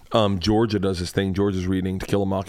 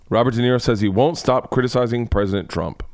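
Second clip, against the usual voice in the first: ordinary talk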